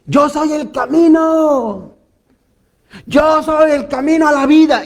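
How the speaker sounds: mock false prophet's cry. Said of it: "voces que también gritan fuerte".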